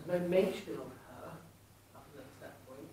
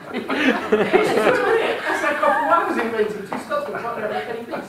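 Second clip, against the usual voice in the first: laughing punchline; 7 semitones higher, 16 dB louder, much slower than usual. Real, not acted.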